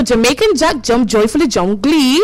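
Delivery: quick, rattled-off tongue twister; fast tongue twister. Shown as a demonstration. Host trying the tongue twister fast, stumbling. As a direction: race through it and trip at the end. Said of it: "let me try and say this fast".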